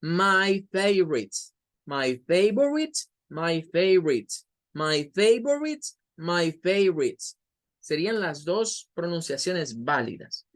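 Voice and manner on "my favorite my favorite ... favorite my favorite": "my favorite" with the o dropped, fav-rit; second pronunciation. Shown as a demonstration. Teacher drilling the shorter British-style favorite. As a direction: repeat "my favorite" as two syllables, eliding the middle vowel. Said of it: "la segunda pronunciación es como favorite".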